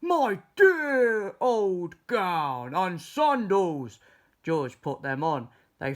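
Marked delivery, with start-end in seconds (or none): 0.0-4.0 slow, high, loud delight; slow loud joyful cry; overjoyed. A narrator's reading. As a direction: savour each word of the found gown. George finds his gown.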